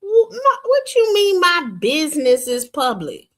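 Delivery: loud, high, indignant what-do-you-mean; outraged disbelief. Real, not acted. Host snapping back at a panelist who called her business public, outraged. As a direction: bristle and throw the question back hard.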